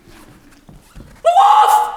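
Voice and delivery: very high, loud scream; cried out. Acted. The pigs scream as the wolf comes. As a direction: shriek in terror. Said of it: "cried one and two".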